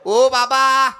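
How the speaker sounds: put-on high-pitched child's call; boy calling father. Boy calls to weeping father.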